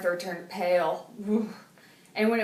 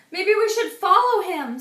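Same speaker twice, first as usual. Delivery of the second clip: eager; 10 semitones higher, 9 dB louder than usual. A narrator's reading. Francine urging friends.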